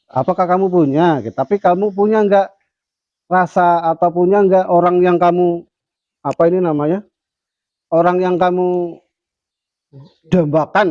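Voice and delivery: high, loud kiai probing his pupil; kiai questioning. Retold or imitated. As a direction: prod the student like an excited old teacher. Kiai questioning his shy student.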